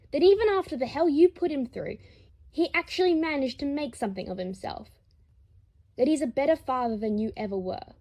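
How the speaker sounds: high, loud and fast, boiling over; furious tirade. Acted. Harry defending his husband to Lucius, furious fast tirade.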